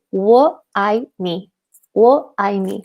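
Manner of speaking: 我爱你, pronounced like a teacher's demo; Chinese for I love you. Shown as a demonstration. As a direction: teach 我爱你 as a careful Mandarin model. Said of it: "you say".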